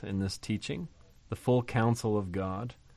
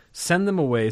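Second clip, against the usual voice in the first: emphatic quote; 7 semitones higher, 4 dB louder than usual. Acted. Disciples' demand voiced again.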